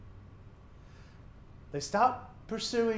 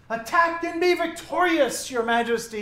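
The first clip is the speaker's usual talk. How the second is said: mock proclamation; 8 semitones higher, 6 dB louder than usual. Prophet mocks king.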